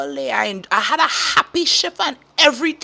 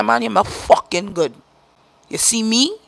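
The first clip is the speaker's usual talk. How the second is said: ordinary talk, nothing marked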